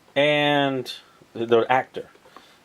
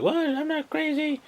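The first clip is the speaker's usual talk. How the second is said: high squeaky offended protest; indignant Queenie; 4 dB quieter than usual. Retold or imitated. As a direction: squeak it out like she's insulted. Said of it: "she gets all like".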